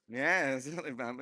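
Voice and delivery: ordinary talk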